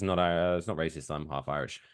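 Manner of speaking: ordinary talk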